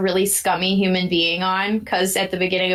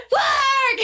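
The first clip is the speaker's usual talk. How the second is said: high-pitched excited shriek; yelling. Retold or imitated. Guest judge yelling at her camera, high excited yell. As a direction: shriek it like an overexcited fan on camera. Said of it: "like, yelling the whole time".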